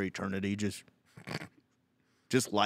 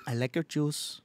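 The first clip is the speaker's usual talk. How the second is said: put-on higher-pitched Filipino-accented goodbye; Filipino tenant; 5 semitones higher than usual. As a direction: sign off as a Filipino tenant, half laughing. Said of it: "Just a Filipino tenant".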